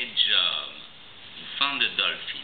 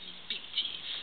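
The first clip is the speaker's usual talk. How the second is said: spooky whisper; 14 dB quieter than usual. Acted. Shark's teeth, spooky.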